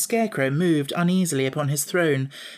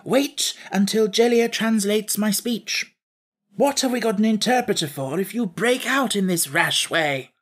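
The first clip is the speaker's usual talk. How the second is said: higher-pitched admonishing voice; cautioning; 7 semitones higher than usual. A narrator's reading. Scarecrow cautioning Jack not to break out rashly, admonishing. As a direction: rein in a rash interrupter. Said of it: "cautioned the Scarecrow".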